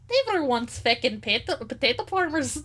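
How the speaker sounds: a bad attempt at an Irish accent, breaking into a laughing shout. Player trying an Irish accent for the redheaded characters, laughing. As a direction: ham up a hammy Irish brogue on "feckin'". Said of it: "I'm gonna, you know what".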